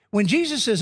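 normal talking voice

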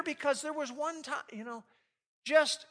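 usual speaking voice

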